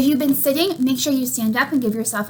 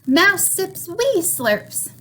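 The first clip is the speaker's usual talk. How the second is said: ordinary delivery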